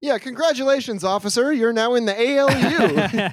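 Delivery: hammed-up announcement to a cop; mock congratulations.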